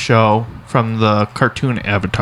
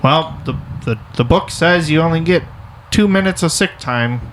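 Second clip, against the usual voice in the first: mock by-the-book boss, higher-pitched; rule-quoting boss; 6 semitones higher than usual. Unsympathetic boss citing the book on sick time, mock officious. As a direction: imitate a stickler reciting policy, pitched up. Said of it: "it's not just like".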